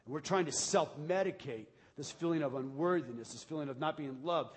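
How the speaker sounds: ordinary talk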